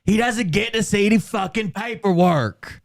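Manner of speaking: high-pitched mock yell; imitated yell. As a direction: mimic her yelling, pitch jumping way up. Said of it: "And she yells".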